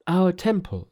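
high-pitched, explaining priest; earnest Buddhist priest. Acted. Priests invite Tripitaka.

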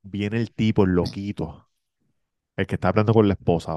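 normal talking voice

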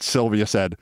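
plain reading voice

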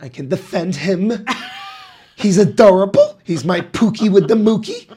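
high, loud gushing mother with laughs; doting mom voice. Retold or imitated. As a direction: gush like a proud mother. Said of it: "She's like".

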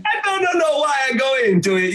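mock carefree shout. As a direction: blurt it out without a care, pitched high. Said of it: "You were just like".

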